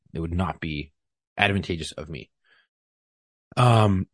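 ordinary talk, nothing marked

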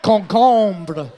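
concombre said in French; French word. Shown as a demonstration. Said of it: "When I was in that French band".

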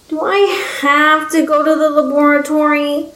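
high pouty whine; pouting child. A narrator's reading. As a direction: pout the question like a sulky child. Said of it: "her bottom lip jutting out".

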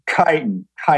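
chitin with a hard k, KY-tin; correct chitin. Shown as a demonstration. Guest corrects the host's word, chitin said KY-tin. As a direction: say chitin as KITE-n. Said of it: "it's called chitin".

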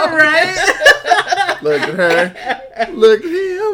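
high, loud words through laughter; laughing. Real, not acted. Co-host cracking up at the R. Kelly comparison, laughing. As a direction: lose it laughing mid-phrase.

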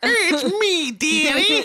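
put-on high, loud cackly old woman; old crone voice. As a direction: play a sweet-creepy old crone greeting. Voicing the old woman character.

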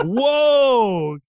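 high, loud, drawn-out mock whoa; amazed crowd. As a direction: exaggerate loud awe, stretching it out. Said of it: "Everyone's like".

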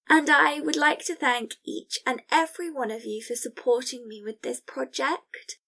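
ordinary talk, nothing marked

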